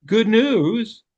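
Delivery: ordinary talk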